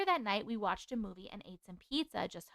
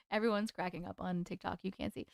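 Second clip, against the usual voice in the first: ordinary talk, nothing marked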